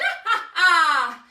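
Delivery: loud, cackling witch laugh. Acted. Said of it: "The witch laughed aloud".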